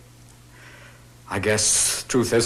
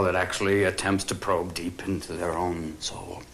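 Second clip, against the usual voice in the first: ordinary delivery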